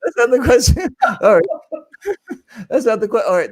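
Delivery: laughing through the words. Real, not acted. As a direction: laugh it off as you correct yourself. Laughing off his own joke.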